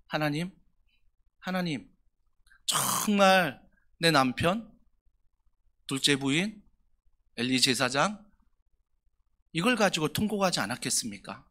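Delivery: hushed lament with long pauses; imagined lament. Retold or imitated. Pastor imagining Hannah's prayer, soft and halting. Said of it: "한번 상상해 보자고요".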